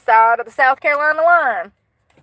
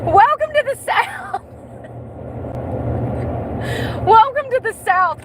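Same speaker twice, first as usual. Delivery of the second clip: mock welcome; 13 semitones higher than usual. Mock welcome, high pitch, laughing.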